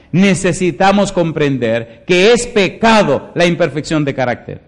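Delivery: loud, ringing pulpit reading; preaching at full force. Acted. Preacher reading scripture to the congregation, loud and ringing. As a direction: proclaim each word to the whole church.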